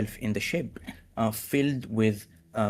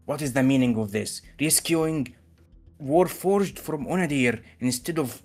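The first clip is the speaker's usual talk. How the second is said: indignant noble rant, climbing higher to a near shriek; outraged noble; 5 semitones higher than usual. Acted. Snotty mayor berating the captain, rising indignant rant.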